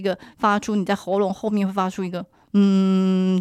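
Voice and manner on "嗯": loud steady buzzing hum; bee voice. Shown as a demonstration. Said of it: "叫蜜蜂的声音啦". (蜜蜂般的嗡嗡声)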